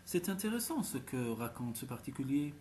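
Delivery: usual speaking voice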